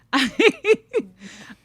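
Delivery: loud, high laugh through tears; a mother laughing through tears, high and loud; teary laugh. Real, not acted. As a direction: let a choked-up laugh burst out. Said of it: "trying to cry right now".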